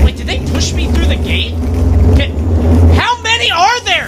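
high, panicked yelps; panicking gamer. Real, not acted. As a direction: freak out as enemies close in. Panicking in horror game.

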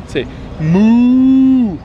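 high, loud singsong coaxing; reading to a toddler. Acted. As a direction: stretch the moo out for the baby.

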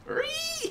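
piercing high ree squeal. Real, not acted. Gamer squealing high at the cows. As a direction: squeal like a toy.

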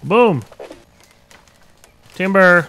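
loud triumphant shout; shouting timber. Real, not acted. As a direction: yell it as the tree falls. Tree falls.

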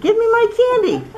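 put-on high insistent girl's voice; upset girl. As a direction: pitch up like an indignant little girl. Child demands candy back.

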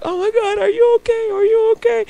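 high, loud frantic mock panic; traumatized panic. Traumatized driver checking on the pedestrian they hit, mock panic. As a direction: cry out in exaggerated high-pitched alarm. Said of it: "these drivers just traumatized".

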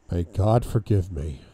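ordinary delivery

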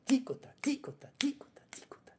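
ticota whispered, T stressed; quick whispered ticota with a hit on T; accented whisper. Shown as a demonstration. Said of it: "So remember, phrasing".

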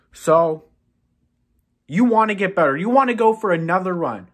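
normal talking voice

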